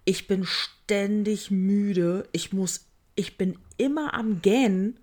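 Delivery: ordinary talk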